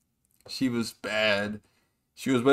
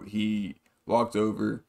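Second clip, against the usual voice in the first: ordinary talk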